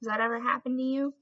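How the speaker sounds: ordinary talk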